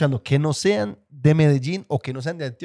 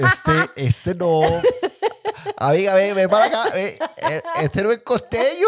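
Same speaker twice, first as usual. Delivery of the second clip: hammed-up costeño accent, high and laughing; badly faked Colombian coastal accent, pitched up; intento de acento costeño; 6 semitones higher, slower than usual. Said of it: "lo mejor que yo puedo hacer de acento costeño". (acento costeño exagerado)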